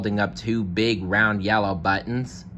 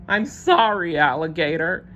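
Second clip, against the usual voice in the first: sobbing; 9 semitones higher, 3 dB louder than usual. Acted. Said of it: "he sobbed".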